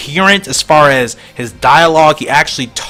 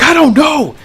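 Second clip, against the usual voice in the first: very high exclaimed answer; alarmed reply; 14 semitones higher, 4 dB louder than usual. Acted. Goboro answering onlooker, alarmed cry.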